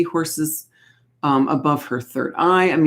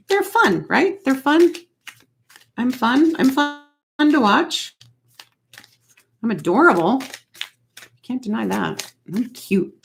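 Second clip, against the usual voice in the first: much higher, sing-song self-praise; playful cutesy voice; 9 semitones higher than usual. Pretend. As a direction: tease the viewers in a cute squeaky tone. Jokes about herself being adorable.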